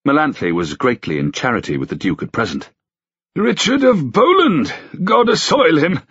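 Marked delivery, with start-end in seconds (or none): 3.3-6.0 put-on high, slow, delighted old king's exclaim; pleased old king. A narrator's reading. Old king greeting a friend's daughter, delighted. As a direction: ring out his pleasure like an aged king. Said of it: "Edward exclaimed with pleasure in his voice".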